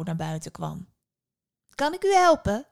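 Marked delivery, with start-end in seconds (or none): 1.7-2.7 put-on high, loud young woman's voice; helpful young woman. A narrator's reading. Young woman to a visiting stranger, helpfully. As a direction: offer help in a high, raised voice.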